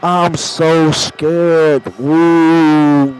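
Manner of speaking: loud, drawn-out sing-song "I'm so scared"; mock scared taunt. Taunting the rival player, loud mock-frightened singsong. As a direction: hoot and stretch it out slowly to mock him. Taunting opponent during the game.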